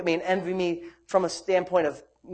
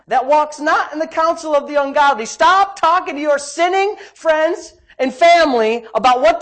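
loud, raised preacher's delivery; forceful preaching; 6 semitones higher, 7 dB louder than usual. Real, not acted. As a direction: drive it home to the congregation.

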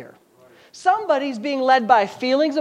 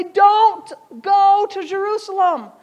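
high, loud plea not to go; pleading disciples; 7 semitones higher, 6 dB louder, slower than usual. Retold or imitated. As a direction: beg him to stay away, voice up high. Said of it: "they were trying to say".

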